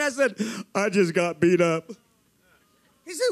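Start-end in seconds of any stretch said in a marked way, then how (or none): 0.7-1.9 low, slow confession; heavy, beaten-down mock admission; retold dejection. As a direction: drop the voice low and drag it out. Retelling his drunk, beaten self.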